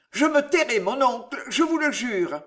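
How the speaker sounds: put-on high, loud pleading young man; pleading nephew. A narrator's reading.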